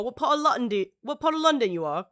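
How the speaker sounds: playful hint of a British accent; mock British accent. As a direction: toss it off in a mock London accent. Mimicking how Brits place accents.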